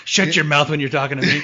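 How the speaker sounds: laughing through a mock threat; high, laughing taunt.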